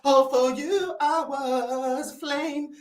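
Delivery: slow, crooning mock of a soulful singer. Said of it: "This Brazilian [name]".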